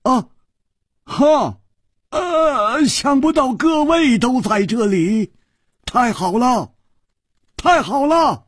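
high, booming delighted king; overjoyed, hearty exclaiming. A narrator's reading. King overjoyed to meet friends here, exclaiming high and jubilant. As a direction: burst out in ringing high delight. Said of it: "抚掌大笑道".

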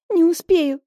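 громкий высокий возглас; выкрикни в панике; отчаянный крик. Acted. Flask falling, desperate cry. (отчаянный выкрик)

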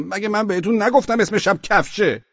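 high, loud rebuke; scolding shout. A narrator's reading. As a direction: yell at your soldier for getting it wrong. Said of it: "سر چراغ علی داد زدم". (داد زدن سر سرباز)

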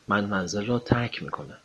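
ordinary talk

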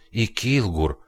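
high, shaken voice; terrified mutter. Acted. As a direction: gasp it in horror. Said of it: "в ужасе пробормотал Дженнингс".

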